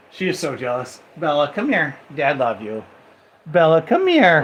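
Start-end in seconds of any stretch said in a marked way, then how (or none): 3.5-4.4 louder, insistent call to a pet; calling the dog. Real, not acted. Owner calls jealous dog Bella over, loud summons.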